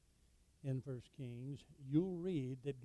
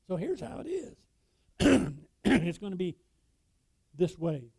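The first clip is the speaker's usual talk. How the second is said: rough king; 2 semitones higher, 12 dB louder than usual. Retold or imitated. Preacher voicing the king harshly answering the people, loud and rough. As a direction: lay down the law like a rough king. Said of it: "He answered them roughly".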